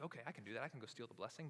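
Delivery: soft, low-voiced yes to the scheme; hushed agreement. Jacob agreeing to his mother's plan, hushed. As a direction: agree quietly, in on the secret.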